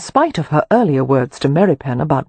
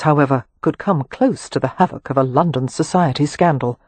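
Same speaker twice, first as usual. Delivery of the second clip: ordinary delivery, nothing marked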